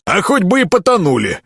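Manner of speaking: tossed off high and careless; carefree. A narrator's reading. Miller shrugs off drowning prisoners, carefree. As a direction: brush the question off lightly. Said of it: "беспечно ответил Мельник".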